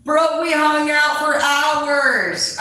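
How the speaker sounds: high, loud, slow and thrilled; excited shout. Real, not acted. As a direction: shout the surprise with delight. Excited reveal of the story.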